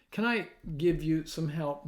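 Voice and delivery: usual speaking voice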